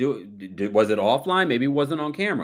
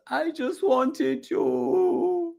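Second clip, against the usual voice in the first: very high, very slow simpering whine; mock simpering Julia; much slower than usual. Mocking Julia's greeting to her friends, high drawn whine. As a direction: stretch it out high and pitiful. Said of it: "she's just".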